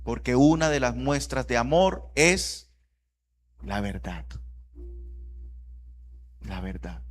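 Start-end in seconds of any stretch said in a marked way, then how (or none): 3.6-7.0 low, soft, weighty repetition; hushed solemnity. Real, not acted. Preacher telling church what love is, hushed and solemn. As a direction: let it drop low and quiet.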